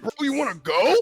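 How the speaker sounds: high-pitched goon picking a fight, acted; belligerent challenge. Lackey starting a fight, acted.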